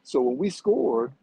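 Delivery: ordinary talk, nothing marked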